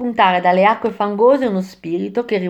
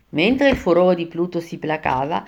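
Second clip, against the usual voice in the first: ordinary delivery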